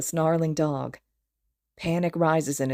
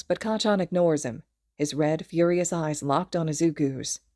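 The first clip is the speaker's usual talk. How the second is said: ordinary delivery, nothing marked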